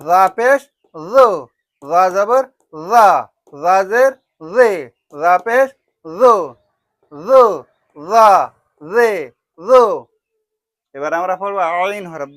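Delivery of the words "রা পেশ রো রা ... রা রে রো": loud, clipped teacher drill of Arabic syllables রো রা রে; loud harakat drill, ro ra re, for the children. Shown as a demonstration. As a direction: call out each syllable clearly for pupils to repeat. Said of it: "দেখো".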